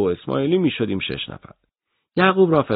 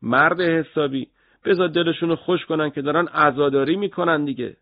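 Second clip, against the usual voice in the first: dismissive superior; 7 semitones higher than usual. Acted. Officer brushing off a worried subordinate, dismissive. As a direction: let them mourn, wave it away. Character voice in audiobook.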